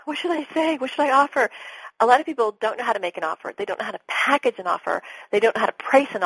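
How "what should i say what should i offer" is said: mock whiny client. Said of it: "people are like".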